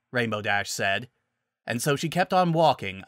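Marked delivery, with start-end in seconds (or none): none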